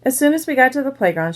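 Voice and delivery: ordinary delivery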